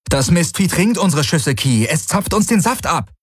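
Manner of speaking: high-pitched, agitated outburst; heated, pitch well up; urgent explanation. A narrator's reading. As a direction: explain it urgently, mid-battle. Pilot explains urgently under attack.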